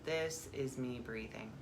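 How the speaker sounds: calm meditation guide, long pauses; guided meditation. Acted. Guide turns listeners inward to the breath, calm meditation voice. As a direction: ease them into stillness, phrase by phrase.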